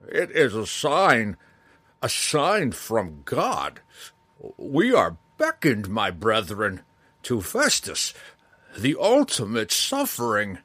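loud slow zealot preaching; fanatical monk. Acted. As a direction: rave like a wild-eyed zealot. Jenkin's fanatical outburst.

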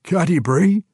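loud, high, astonished question; astonished dwarf; breathless surprise. A narrator's reading. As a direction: gasp the name in disbelief. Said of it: "just as breathlessly".

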